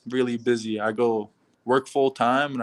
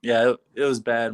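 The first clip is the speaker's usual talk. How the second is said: normal talking voice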